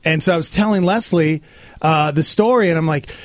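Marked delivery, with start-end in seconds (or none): none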